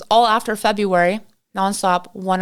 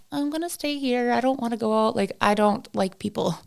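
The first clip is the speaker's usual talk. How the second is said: soft, small voice; timid homebody; 5 dB quieter than usual. Retold or imitated. House hermit declining to go out with everybody, small timid voice. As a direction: shrink back and say it quietly.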